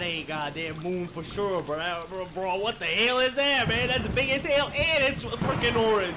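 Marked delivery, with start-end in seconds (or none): none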